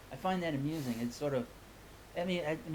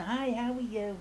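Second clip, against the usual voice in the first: Bubbly Edna; 5 semitones higher than usual. Acted. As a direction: pop up bright as a cheerful hostess persona. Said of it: "I gonna bring back Bubbly Edna".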